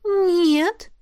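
drawn-out unsure spirit. Acted. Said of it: "растерянно протянула Искра".

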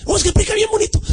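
very high mock-admiring voice; gushing church member. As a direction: mimic a swooning fan of the preacher.

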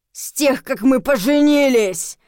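slow, loud rising growl; angry growled retort; snarling. A narrator's reading. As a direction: roar it back, drawn out. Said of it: "рыкнул он".

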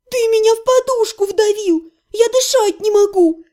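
задыхаясь, возмущённо; breathless protest. A narrator's reading. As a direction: gasp it out from under him. Crushed, can't breathe.

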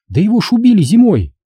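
put-on high, fast girlish exclamation; girl breaking news. A narrator's reading.

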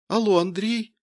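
lightly voiced woman calling by phone. A narrator's reading. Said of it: "послышался в телефоне женский голос".

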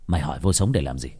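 low, fast, menacing question; intimidating snap. A narrator's reading. Cousin challenging Nam over his question, low intimidating snap. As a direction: throw the question back as a threat. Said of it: "nói bằng giọng nạt nộ".

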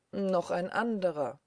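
usual speaking voice

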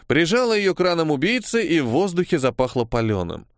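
ordinary delivery

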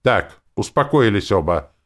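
loud, firm interruption. A narrator's reading. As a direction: raise your voice to settle a quarrel. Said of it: "повысил я голос".

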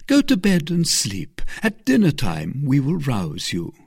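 a hint of a caring mother superior; the abbess. A narrator's reading. Abbess offers rest.

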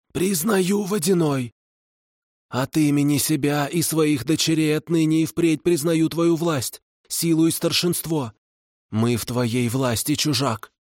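high, humbled hag voice; bow and concede formally; submissive kikimora. A narrator's reading. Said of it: "покорно склонила голову".

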